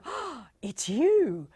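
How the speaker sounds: big gasp, slow delighted gush; gushing greeting. Acted. Gushing at someone you don't know well, slow mock delight. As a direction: gasp and stretch it out adoringly. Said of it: "don't walk up and go".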